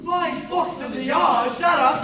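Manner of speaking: pompous shout. Acted. Inspector announcing himself to the cast, shouting. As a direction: bark it like a bumbling inspector.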